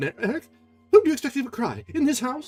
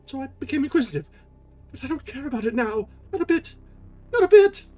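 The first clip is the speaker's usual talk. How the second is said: much higher, pleading frightened voice; anguished; 7 dB louder than usual. Acted. Christine begging Erik in the dark, anguished. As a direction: beg him in terror. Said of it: "Christine's voice of anguish".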